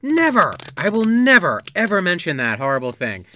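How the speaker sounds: loud, high mock outrage; hammy vow of silence; dramatic refusal. Mock refusal to tell incident.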